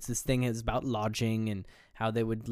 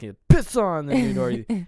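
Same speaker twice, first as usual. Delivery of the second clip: retold spitting contempt; 7 semitones higher, 7 dB louder than usual. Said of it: "he'd spit on him".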